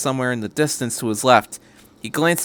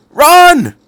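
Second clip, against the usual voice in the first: much higher, much louder yell; shout; 14 dB louder than usual. A narrator's reading. Doctor yells to everyone to flee the train. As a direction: drive everyone out the door. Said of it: "he shouted".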